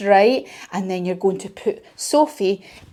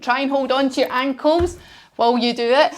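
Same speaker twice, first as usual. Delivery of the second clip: ordinary delivery, nothing marked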